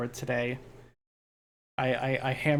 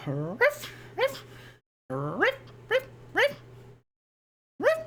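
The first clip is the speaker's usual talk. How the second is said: dog bark; slower than usual. Pretend. Playful barking noises.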